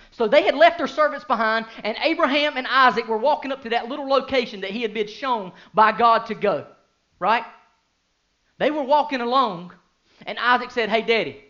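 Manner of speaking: loud, high, impassioned preaching; full-force preaching. Acted. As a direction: preach it out to the whole congregation. Preaching the Isaac story.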